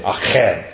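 "Echad" with a harsh throaty spat ch, low and falling. Shown as a demonstration. Pastor teaching the Hebrew word, spitting the ch. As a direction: spit the ch in "Echad". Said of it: "like you're spitting".

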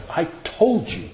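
lightly voiced mother warning "I told you"; mom's I told you. Mother warning her child in trouble, lightly imitated scolding. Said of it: "when my mom said it that way".

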